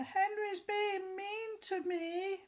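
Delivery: high, slow crying wail; sobbing tattletale cry; whiny shout. A narrator's reading. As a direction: wail it like a tattling little brother. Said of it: "shouted Peter".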